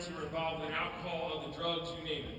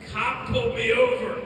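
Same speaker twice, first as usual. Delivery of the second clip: loud, raised cartoon voice; brother's Rick voice; 4 semitones higher, 12 dB louder than usual. Brother reports being stopped by cop, loud Rick-like voice. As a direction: imitate a brother reporting his trouble loudly. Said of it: "his story sounded like that".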